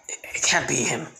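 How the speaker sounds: hushed whisper to himself. Acted. Naruto whispering suspicions to himself about Izuku. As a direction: doubt it under your breath.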